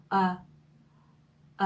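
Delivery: "어" said with rounded O lips while sounding e; eu vowel demo. Shown as a demonstration. Teacher showing students the French eu sound. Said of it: "이렇게 발음을 하시면 돼요".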